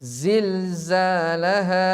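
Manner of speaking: Zilzalaha slowly with buzzing z. Shown as a demonstration. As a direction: stretch the z buzz.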